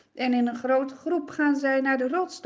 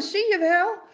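higher, louder angry shouting; shouting angrily; 8 semitones higher, 7 dB louder than usual. Acted. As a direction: hurl complaints at Moses. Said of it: "beginnen ze allemaal te roepen en te schreeuwen".

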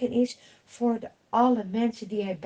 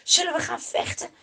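eager question; 8 semitones higher, 5 dB louder, faster than usual. Acted. Soldier eager to fight.

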